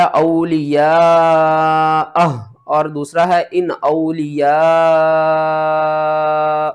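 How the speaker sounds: slow, drawn-out recited words; recitation reading. Shown as a demonstration. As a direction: intone each word like in recitation. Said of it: "teacher reads words in recitation".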